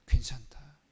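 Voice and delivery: Jesus whispering reassurance; whispered comfort. Pretend. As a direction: whisper it like soothing a child. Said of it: "예수님께서 뭐라고 하셨냐".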